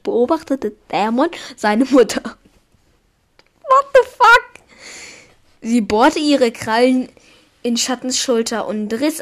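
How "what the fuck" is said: shocked. Real, not acted. Shocked reaction to story.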